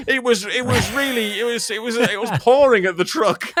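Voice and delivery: high, laughing mock excuse-maker. Friend teasing a colleague about his reverse story, mocking excuses.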